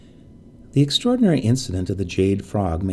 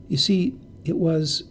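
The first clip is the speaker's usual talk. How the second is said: hesitant; 6 semitones higher than usual. A narrator's reading. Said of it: "she hesitated".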